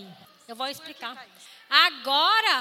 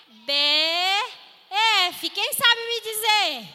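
voz de professora de jardim de infância; 5 dB louder than usual. Acted. Professora falando com crianças.

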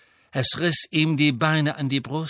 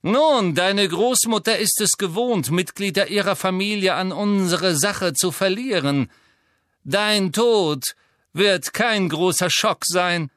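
sneering; 6 semitones higher, 4 dB louder than usual. A narrator's reading. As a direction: taunt the captive boy. Malfoy continues taunt.

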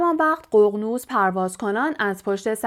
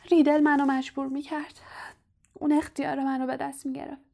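put-on higher, softer, tearful girl's voice; tearful confession; 5 semitones higher, 3 dB quieter than usual. A narrator's reading. Ginny confessing to Harry through tears, softly tearful. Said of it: "اشک از چشمهایش سرازیر شد و گفت".

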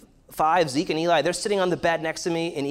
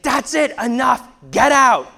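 reenacted yell, much higher and louder; angry shout; 9 semitones higher, 9 dB louder than usual. Father throwing fighting boys out of the room, shouting. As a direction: order them out at full volume.

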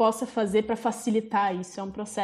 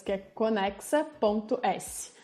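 ordinary talk, nothing marked

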